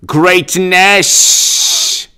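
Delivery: slow sermon-like mock of a governor; mocking Cuomo. Mocking the governor's preachy speech, sermon style. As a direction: intone it like a pompous sermon. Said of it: "he speaks in this hilarious way".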